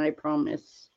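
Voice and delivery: ordinary talk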